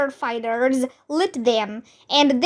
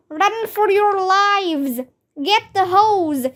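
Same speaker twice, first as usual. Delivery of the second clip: higher urgent commands; shouted; 6 semitones higher than usual. Acted. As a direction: bark orders in alarm. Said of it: "shouted one of the firefighters".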